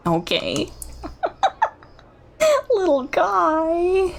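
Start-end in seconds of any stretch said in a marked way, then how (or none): 2.7-4.1 cooing. Real, not acted. Cooing at a creature.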